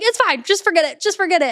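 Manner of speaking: high, dismissive brush-off. Retold or imitated. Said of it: "I'm just like".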